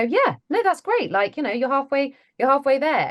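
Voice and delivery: high, bright encouraging voice; big them up with a high encouraging lilt. Retold or imitated. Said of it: "I always wanna just go".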